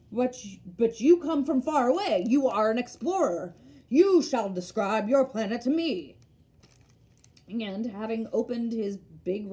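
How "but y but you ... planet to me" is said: louder, eager geographer bursting out; suddenly keen, leaning in to the traveller; stirred to excitement. A narrator's reading. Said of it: "The geographer was suddenly stirred to excitement".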